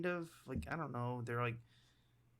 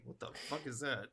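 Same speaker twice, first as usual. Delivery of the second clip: much softer, under the breath; quiet repeat; 10 dB quieter than usual. Retold or imitated. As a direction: drop it to a hushed murmur. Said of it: "that's exactly what his face is saying".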